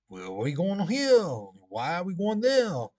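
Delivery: hammed-up French movie accent. Teacher to class, imitating movie Frenchmen in French accent. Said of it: "that's the way he talked in the movies, with that French accent".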